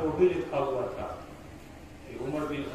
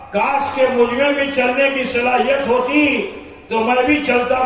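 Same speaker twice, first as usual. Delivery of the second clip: loud fervent plea; 7 semitones higher, 11 dB louder than usual. Acted. Old man urges Umar to embrace Islam, loud and high. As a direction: urge him with full fervor. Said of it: "تو کہا".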